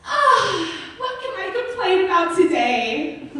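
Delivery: high-pitched mock morning sigh; eager complainer. Friend waking up hunting for something to gripe about, high mock eagerness. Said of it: "She wakes up every morning".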